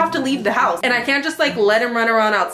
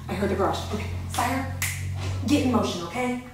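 softer hushed voice while he's about to walk in; hushed waiting; 9 dB quieter than usual. Real, not acted. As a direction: keep it down so he won't hear you. Keeps quiet before boyfriend arrives.

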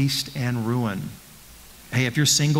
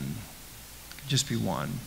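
much softer and slow, letting it sink in; hushed slow repeat; 6 semitones lower, 12 dB quieter, much slower than usual. Shown as a demonstration. Preacher repeating his warning to the congregation, hushed and slow. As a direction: drop to a near hush and slow right down.

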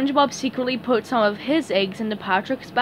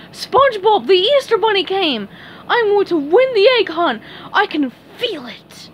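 high, loud cartoon excitement; excited Patrick; 10 semitones higher, 6 dB louder than usual. A narrator's reading. Patrick bursts in to announce the Easter Bunny, excited. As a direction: burst in loudly, bubbling over. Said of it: "Suddenly, Patrick burst in".

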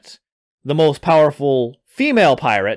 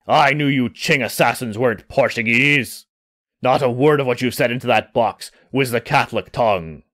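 a hint of a suspicious pirate, lower than narration; lightly voiced accusing pirate; 6 semitones lower, faster than usual. Yao accuses the strangers of lying, lightly voiced suspicion. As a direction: lightly voice a pirate calling out a lie.

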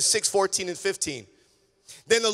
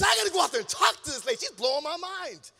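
high, excited retold outburst; amazed excitement; 9 semitones higher, faster than usual. Dad bursting to go talk to lady, amazed. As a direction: act out dad's astonishment, pitched high.

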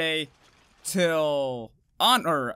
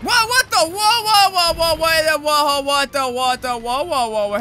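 loud, higher panicked whoa whoa; alarmed whoas; 8 semitones higher, 8 dB louder than usual. Real, not acted. Streamer caught off guard by the monster, loud panicked whoas. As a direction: blurt out rapid alarmed whoas as it pounces.

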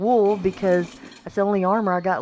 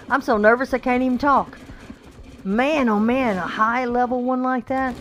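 ordinary talk

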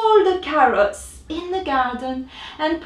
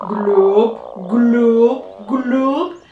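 louder gurgling gloops; tummy noises; 6 dB louder, much slower than usual. A narrator's reading. Rabbit's tummy still rumbling, louder gloops. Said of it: "still making hungry noises".

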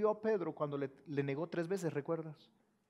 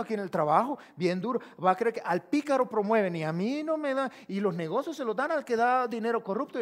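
complaining believer; 3 semitones higher, 8 dB louder than usual. Acted. Preacher acts complaining believer, rising.

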